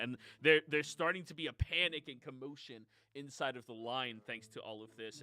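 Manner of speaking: ordinary talk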